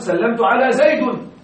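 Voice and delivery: زيد pronounced "Zaydun" as a wrong example; wrong nominative ending. Shown as a demonstration. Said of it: "لا تقل".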